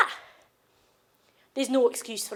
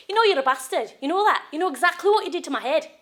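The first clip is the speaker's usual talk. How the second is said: louder, indignant accusation; angry accusation; 2 semitones higher, 5 dB louder, faster than usual. Acted. Battered partner confronting abuser at home, angry accusation. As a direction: confront him with raised voice.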